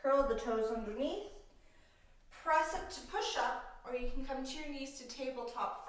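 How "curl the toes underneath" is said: ordinary talk, nothing marked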